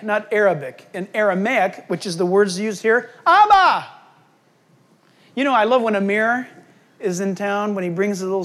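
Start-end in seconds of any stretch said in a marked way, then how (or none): 3.3-3.9 "Abba!" called out high and loud, stress on the first syllable AH-ba; calling Abba. Shown as a demonstration. Preacher cries out "Abba!" as a child would call.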